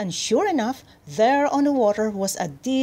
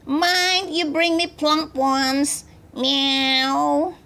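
cross cat, high and slow; Missus Ginger; 6 semitones higher, 4 dB louder, much slower than usual. A narrator's reading. Mother cat demanding plump birds, cross slow cat voice. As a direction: warn crossly, drawn out, with a meow. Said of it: "said bad Missus Ginger very crossly".

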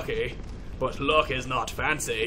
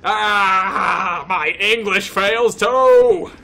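loud, higher, drawn-out ranting; mock outrage; 5 semitones higher, 6 dB louder than usual. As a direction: rant theatrically at the treasure robber. Mock outrage at lost treasure.

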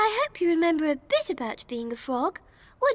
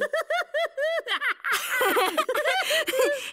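high laugh; 9 semitones higher than usual. Acted. Laughing along with hyenas.

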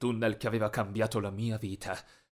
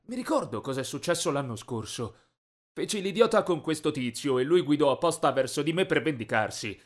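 usual speaking voice

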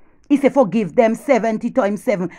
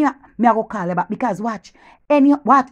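normal talking voice

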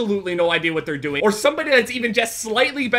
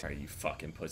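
normal talking voice

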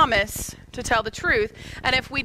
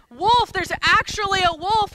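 urgent cry; 7 semitones higher than usual. Retold or imitated. Boy warns the villagers of a real wolf, urgent. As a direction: plead with them to believe it. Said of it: "And the boy says".

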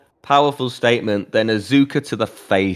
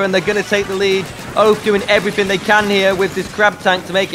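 urgent, climbing caster call; excited play-by-play; 10 semitones higher, 4 dB louder than usual. Acted. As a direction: hold the hype to the final seconds. Live esports play-by-play.

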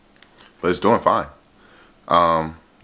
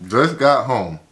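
numb tongue after surgery; numb mouth; 6 semitones higher, slower than usual. Real, not acted. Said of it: "I'm still numb, as you can tell from how I'm talking".

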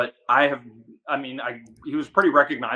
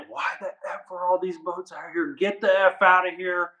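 mild mock yell, pitched up; retold yell; 5 semitones higher than usual. Angry boater cussing out the fishermen, retold as a mild yell. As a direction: imitate an angry yell without full volume. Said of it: "turns around and yells it again".

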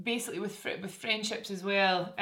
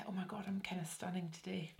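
soft whisper to yourself; 10 dB quieter than usual. Retold or imitated. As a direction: whisper it like a little secret. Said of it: "I'm like".

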